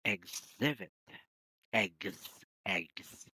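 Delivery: "exhibit" with egg-like gz, then "Eggs, eggs"; correct exhibit. Shown as a demonstration. Teacher gives a memory trick for a word. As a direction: link "exhibit" to the sound of "eggs". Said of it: "the correct pronunciation of that is".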